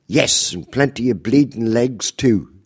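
loud, high Cockney retort; quick as a razor, in a Cockney accent; East End comeback. Retold or imitated. Granny snapping back at the officer, Cockney accent. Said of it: "also an East Ender".

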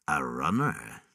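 low, soft awed demon. Acted. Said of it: "it said softly".

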